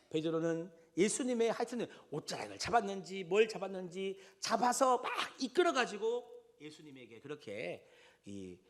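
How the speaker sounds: usual speaking voice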